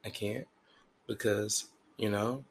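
soft, halting excuse; hushed, hesitant role-play; imagined partner making excuses. Acted. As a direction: stammer it out quietly, reluctant to admit it. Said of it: "And you say".

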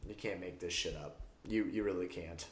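ordinary delivery

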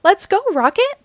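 high, loud rallying cry; excited call. Acted. Cheering on the rocket as the team sets off, excited. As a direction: launch them off with a cheer.

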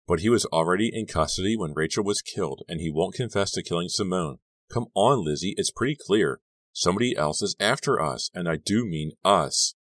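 plain reading voice

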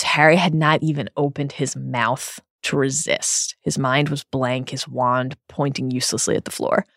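usual speaking voice

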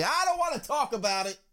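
high, soft mock whine; mock upset refusal. Fan asked about Manny's trade, hammed-up upset refusal. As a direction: shoot the pitch way up, hammed-up and small.

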